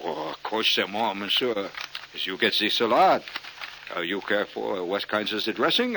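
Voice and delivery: hammed-up French waiter; mock French waiter accent. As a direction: ask politely in fake French. French waiter asks about dressing.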